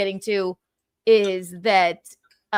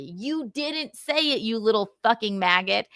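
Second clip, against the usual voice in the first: ordinary talk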